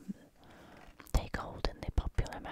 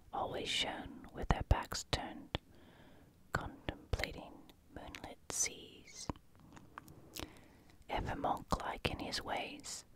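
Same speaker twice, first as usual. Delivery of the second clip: reading in a croaky whisper. Real, not acted. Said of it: "I will try to read with my croaky voice".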